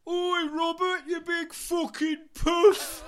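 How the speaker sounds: high, loud, slow drawn-out yell. Retold or imitated. [name] yelling at [name] across backstage, loud. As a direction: bellow it across the stage. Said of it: "And [name] goes".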